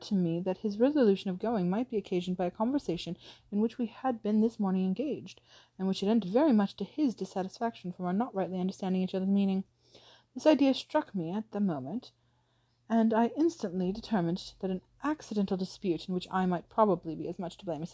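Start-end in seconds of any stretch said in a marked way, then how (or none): none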